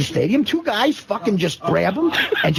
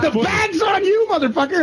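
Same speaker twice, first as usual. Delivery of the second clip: shouted gotcha; 11 semitones higher than usual. Retold or imitated. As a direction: yell the punchline at him. Goons shout prank reveal.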